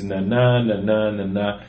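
na, na chanted da-DUM, weak then strong; iambic beat demo. Shown as a demonstration. Explaining poetry meter to co-hosts, chanting the beat. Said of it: "Poetry is very often very iambic".

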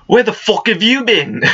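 high-pitched, badly faked Scottish wife yelling; mock-scolding in a clumsy Scottish accent; a wife's angry greeting in a bad Scottish accent; horrible Scottish accent. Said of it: "Excuse my horrible accent".

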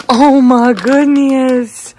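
very drawn-out, cooing amazement; gushing pet talk. Real, not acted. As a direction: stretch every word in delight for your pet. Showing pet the treats.

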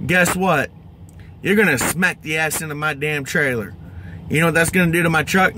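ordinary talk

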